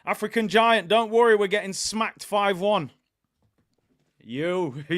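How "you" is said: tough guy reassurance, gangster style; lightly done De Niro impression. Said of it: "That was my De Niro voice".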